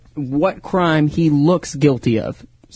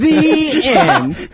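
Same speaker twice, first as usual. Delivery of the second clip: laughing; 10 semitones higher than usual. Real, not acted. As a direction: laugh it out teasingly. Teasing cutoff, laughing.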